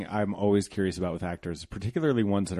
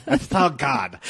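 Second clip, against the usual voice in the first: high-pitched, hammed-up British accent; bad British accent; 9 semitones higher, 4 dB louder, much slower than usual. Host joking about a favorite beer, bad British accent. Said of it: "has a bad British accent".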